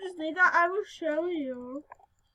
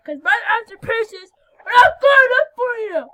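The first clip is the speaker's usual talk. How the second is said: loud, higher indignant huff; mock offended; 6 semitones higher, 12 dB louder than usual. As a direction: act insulted, hamming it up. Mock offended at game decline.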